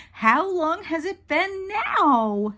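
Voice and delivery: put-on high, drawn-out childish whine; whiny kid wanting an answer; impatient little sister. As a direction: stretch it out, fed up with waiting. Impatient sister waiting for milk.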